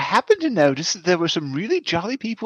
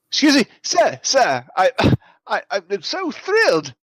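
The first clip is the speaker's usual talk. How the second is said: higher-pitched swooping gushing enthusiasm; gushing merrymaker; 3 semitones higher than usual. Acted. Merrymaker accosts a sour bald man, gushing. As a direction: greet him with over-the-top delight.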